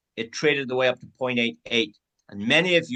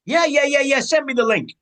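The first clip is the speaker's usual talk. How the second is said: eager yes; 13 semitones higher, 5 dB louder than usual. Acted. Friend eagerly accepts the link.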